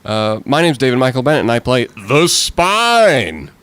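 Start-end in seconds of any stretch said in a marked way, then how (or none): 2.1-3.5 slow, drawn-out character name; grand character announcement. Acted. Introducing his robot role to listeners, grandly drawn out. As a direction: stretch it out like a stage announcer.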